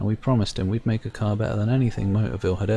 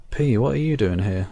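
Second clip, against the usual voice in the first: ordinary talk, nothing marked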